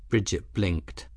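usual speaking voice

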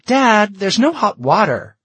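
high, loud indignant complaint; angry daughter. Acted. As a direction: yell it at Dad in outrage. Daughter storms out, yelling at dad.

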